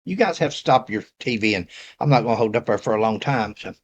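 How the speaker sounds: usual speaking voice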